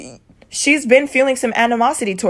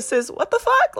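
high-pitched disbelief; 12 semitones higher, 4 dB quieter than usual. Retold or imitated. Acting out reaction to sister's text, high-pitched disbelief. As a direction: pitch leaps way up in playful incredulity. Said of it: "it was like a".

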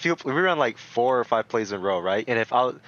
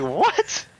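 shocked what; 8 semitones higher than usual. Retold or imitated. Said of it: "I was like".

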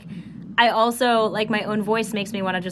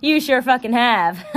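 normal talking voice

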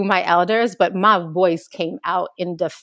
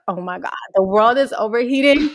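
higher, louder childhood panic, retold; mock freak-out; 5 semitones higher, 5 dB louder than usual. Speaker recalls learning about global warming, freaking out. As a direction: re-enact a kid's alarmed outburst. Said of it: "freaking out".

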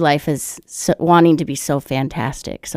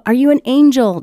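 high, louder mock-mom question; raised voice of a questioning mom; voiced mother; 8 semitones higher, 5 dB louder than usual. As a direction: play a mother guessing eagerly, pitched up. Voicing mother guessing the part.

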